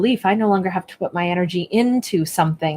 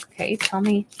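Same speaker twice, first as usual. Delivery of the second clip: ordinary talk, nothing marked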